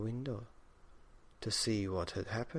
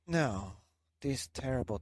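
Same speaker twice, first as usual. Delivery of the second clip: usual speaking voice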